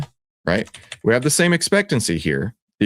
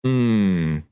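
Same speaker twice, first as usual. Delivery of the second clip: ordinary talk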